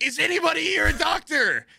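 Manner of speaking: high mock shout to a crowd; urgent calling out. Stewardess calls the cabin for a doctor, urgent shout.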